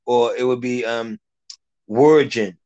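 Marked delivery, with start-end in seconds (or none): 1.9-2.6 "virgin" with a W sound for the V; "virgin" starting like "word", slowly; W pronunciation. Shown as a demonstration. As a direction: say "virgin" as "wor-jin". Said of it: "with a W".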